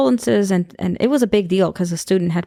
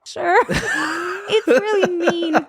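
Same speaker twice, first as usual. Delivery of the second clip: laughing; 9 semitones higher, slower than usual. Real, not acted. Laughing at own comeback.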